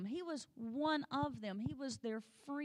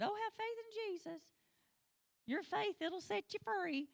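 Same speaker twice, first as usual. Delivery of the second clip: high, sing-song parody of a pious preacher; mock preachy cliché; 9 semitones higher than usual. Preacher mocking how churchgoers recite faith slogans, high sing-song parody.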